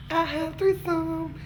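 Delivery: giddy repetition. Real, not acted.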